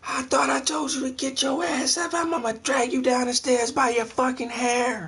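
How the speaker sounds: high-pitched mock screaming threat; hammed-up furious mom shriek, very high; mother yelling at daughters. Neighbor mom getting her little girls up for school, mock furious screech. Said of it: "yell at their little daughters every morning".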